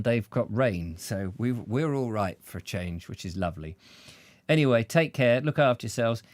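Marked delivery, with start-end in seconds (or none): none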